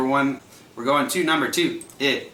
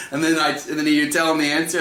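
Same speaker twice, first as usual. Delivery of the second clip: ordinary delivery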